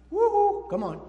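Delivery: high, loud whoop to the crowd; joyful preacher's woo-hoo. Real, not acted. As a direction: rouse the room with a cheer. Preacher whoops to rouse congregation.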